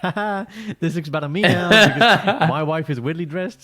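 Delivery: husband gloating at his oddly dressed wife, laughing; mock gloating laugh. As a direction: cackle over the wife's outfit. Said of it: "I'm like".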